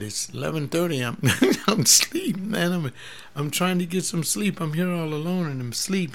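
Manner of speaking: hammed-up half-asleep grumble; sleepy protest. Pastor retelling his late-night phone reply, mock sleepy. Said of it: "And I said".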